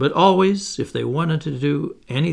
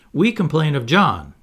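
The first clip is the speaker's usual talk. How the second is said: put-on higher, louder childish complaint; crying out; 4 semitones higher, 7 dB louder than usual. A narrator's reading. The twins telling on John to Wendy, crying out. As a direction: tell on him loudly. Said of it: "cried the twins".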